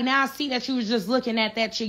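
ordinary delivery

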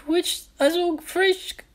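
put-on very high, slow little gnome child voice; gnome child crying. A narrator's reading. Frightened gnome child crying to strangers, very high and slow. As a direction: cry it out like a frightened tiny gnome. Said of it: "he cried".

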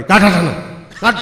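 loud, high shout; forceful yell. Retold or imitated. Father yelling at the boy to get the coin out, loud shout. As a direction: blast the order out. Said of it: "heavy જોશથી રાડ પાડી".

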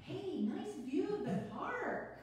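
quick, bubbly, a little flustered; nervous newcomer rattling on; nervous chatty woman. Acted. [name] reading her part.

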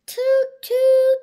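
very loud high toots; boat horns tooting. Acted. Boats on the river sounding loud, high toots. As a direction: blast it like a boat horn.

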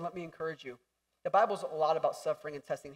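ordinary delivery

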